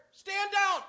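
high-pitched sudden order; called-out command. Acted. Captain halting archers ready to fire, called out high. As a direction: cut in with a called military order.

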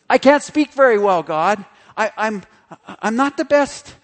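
mock a tongue-tied man pleading with God; flustered Moses. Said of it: "He's struggling".

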